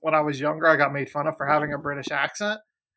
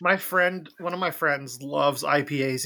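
ordinary talk, nothing marked

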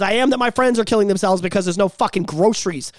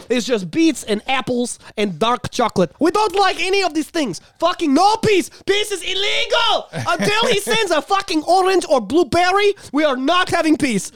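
a hammed-up bad Ukrainian accent, mostly higher; horrible Ukraine accent; 6 semitones higher than usual. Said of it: "I'm trying to do a horrible Ukraine accent".

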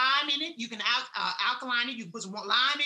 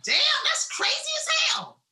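much higher, shocked squeal; shocked disbelief; 13 semitones higher, 4 dB louder than usual. Retold or imitated. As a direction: react amazed at your own words. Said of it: "you'd be like".

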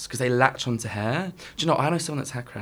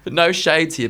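ordinary talk, nothing marked

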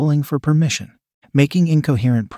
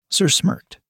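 plain reading voice

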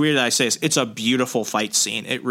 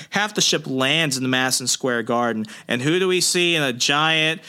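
ordinary talk, nothing marked

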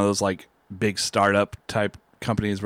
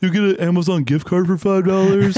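mock announcer; 6 semitones higher, 4 dB louder than usual. Mocking startup office perks.

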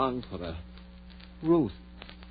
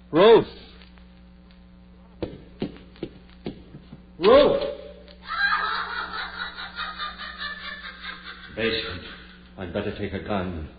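loud searching call; 3 dB louder than usual. Acted. David searches the house for Ruth, loud call. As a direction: call through the dark house.

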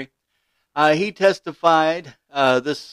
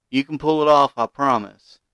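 usual speaking voice

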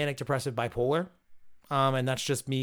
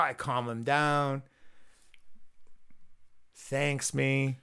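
ordinary talk

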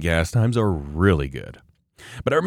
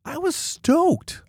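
stoked; much slower than usual. Real, not acted. Recalls joy at being laid off.

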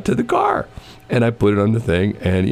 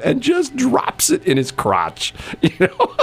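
words breaking into real laughter; laughing; slower than usual. Real, not acted. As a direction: laugh through the punchline. Laughing at his own story.

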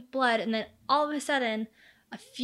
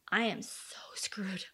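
slow, hushed mock dread; panicked whisper; 3 semitones lower, 9 dB quieter, slower than usual. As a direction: whisper it like you've been caught. Suspect realising he is caught.

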